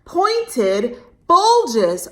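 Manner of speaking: put-on bright, expressive read-aloud for kids; lively storytime narration; storybook reading. A narrator's reading. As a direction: read it like a picture book to a child. Reads story to children.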